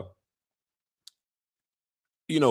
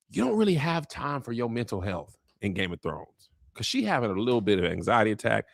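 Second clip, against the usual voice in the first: ordinary talk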